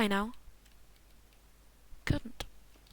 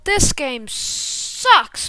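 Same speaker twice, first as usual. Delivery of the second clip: very slow, loud, stretched words; drawn-out, emphatic grumble; exaggerated complaint; 7 dB louder, much slower than usual. Real, not acted. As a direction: drag out every word of the gripe. Sign-off complaint.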